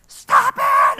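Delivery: full-force high shout; re-enacted desperate yell, very loud and high; retold yell. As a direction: yell it out over a mob. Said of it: "I was just yelling".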